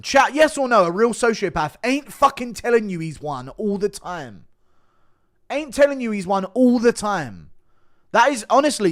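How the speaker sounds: heated rant. Real, not acted.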